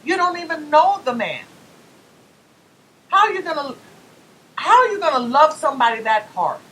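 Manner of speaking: loud, high, incredulous questioning; exasperated retort. Retold or imitated. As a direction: demand why they love a stranger. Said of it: "I'm like".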